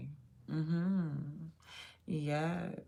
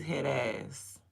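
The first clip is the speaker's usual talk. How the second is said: normal talking voice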